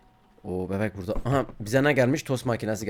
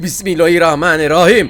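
loud rattled invocation; real fright, calling on God; startled prayer; 7 dB louder than usual. Real, not acted. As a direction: blurt it out shaken. Praying after the jumpscare.

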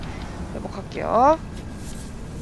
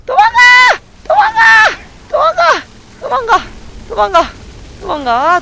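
starts as a high shriek, steps down toward talk; panicked shouting, easing off; 14 semitones higher, 6 dB louder than usual. Real, not acted. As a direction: yell to flee, losing pitch with each repeat. Fleeing from arrows in the game.

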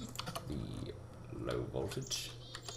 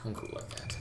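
breathless, half-whispered talking; put-on out-of-breath voice; fake out of breath; 10 dB quieter, slower than usual. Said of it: "talking like I'm out of breath".

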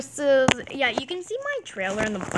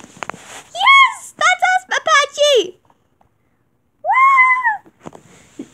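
high, loud squealing cheer; excited cheering; 9 dB louder, much slower than usual. Real, not acted. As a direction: shriek with joy. Cheering their own win.

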